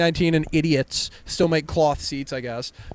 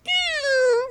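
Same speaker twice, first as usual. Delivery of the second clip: very high squeezed rubber-chicken squeak; shrill toy squeak imitation; squeaky chicken squeal. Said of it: "rubber chicken and go".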